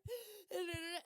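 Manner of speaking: sharp high gasp mid-sob; tearful gasp. Retold or imitated. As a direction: gasp like catching breath while crying. Retold crying fit.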